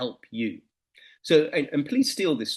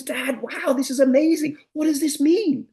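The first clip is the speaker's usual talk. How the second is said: put-on much higher and louder boy; much higher, louder excited lad; amazed son; 14 semitones higher, 7 dB louder than usual. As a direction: burst in excited to dad. Son amazed, high loud.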